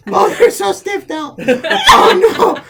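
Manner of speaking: put-on high, loud, breathless laughing old woman; laughing hyena. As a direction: cackle and gasp through every word. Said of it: "goes full laughing hyena".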